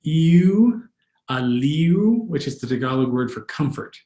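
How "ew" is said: "Ew" said with a scrunched-up nose. Shown as a demonstration. Tutor shows the cockroach-noise "Ew" sound. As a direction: pull a disgusted face and say "Ew". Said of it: "very scrunched up nose when you do this one".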